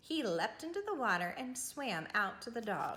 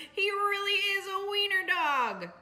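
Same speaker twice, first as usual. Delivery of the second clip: high, louder teasing; mocking; 10 semitones higher, 6 dB louder than usual. A narrator's reading. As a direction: tease him with a grin. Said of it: "they cried".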